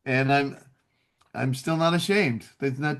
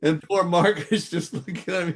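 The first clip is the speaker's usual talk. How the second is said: normal talking voice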